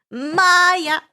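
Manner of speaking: loud high shout; command shout. A narrator's reading. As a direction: call out the magic word.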